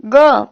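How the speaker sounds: ग said as a voiced throat fricative, gh; nukta pronunciation. Shown as a demonstration. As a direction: demonstrate ग with a nukta, as an isolated sound. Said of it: "इस तरीके से पढ़ते हैं".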